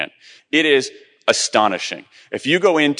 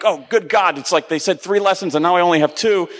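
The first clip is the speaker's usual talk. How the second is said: inner panic; 7 semitones higher than usual. Retold or imitated. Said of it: "I was thinking".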